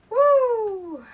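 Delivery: loud, high hype cheer; excited whoop. Real, not acted. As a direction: shout it out with hype. Hyped before entering area.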